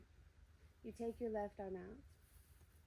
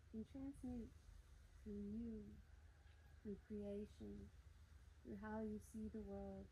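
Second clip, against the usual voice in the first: hushed guided meditation; 12 dB quieter than usual. Acted. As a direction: ease them gently inward. Soft guided meditation lines.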